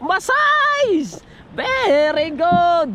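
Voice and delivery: drawn-out, delighted exclamation; excited cheer. Real, not acted. Fisherman cheering a big catch, drawn-out excitement. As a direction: stretch each word out in celebration.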